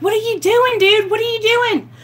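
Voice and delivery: retold yelling. Woman yelling at boyfriend who threw her phone out, high mock yell. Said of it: "I'm screaming. I'm yelling".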